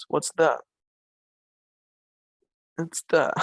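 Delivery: ordinary delivery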